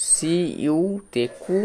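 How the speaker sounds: very slow, letter by letter spelling. Shown as a demonstration. Teacher to pupils, spelling slowly. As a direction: draw each letter out for the class.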